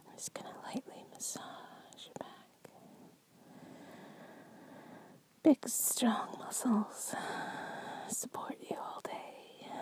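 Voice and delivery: soft ASMR whisper. Acted. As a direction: breathe it to your partner.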